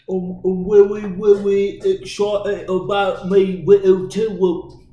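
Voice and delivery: loud, high, slow mock Cockney; over-the-top Cockney yell; Cockney accent. Shown as a demonstration. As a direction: bellow it in an exaggerated Cockney accent. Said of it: "I do, like, the Cockney one".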